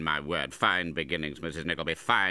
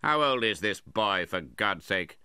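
loud, raised, scornful demand; intense scorn; 5 semitones higher, 7 dB louder than usual. A narrator's reading. Uncle sizing up his nephew, scornful. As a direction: demand it with open contempt. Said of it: "intense scorn".

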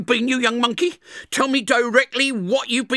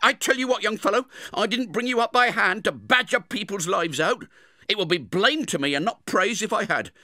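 high, loud reproaching voice; stern sister; 13 semitones higher, 5 dB louder than usual. Acted. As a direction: send the boy off to bed scolding. Sister lectures Pip.

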